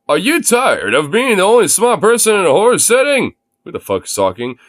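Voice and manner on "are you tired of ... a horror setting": upbeat ad announcer pitch; infomercial voice. Acted. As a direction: sell it like a TV ad. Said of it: "Read in infomercial voice".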